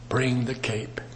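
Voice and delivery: soft unvoiced whisper of Paul's request; whispered bring the cape; hushed plea. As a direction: whisper it like an old man asking. Preacher voicing Paul's request softly.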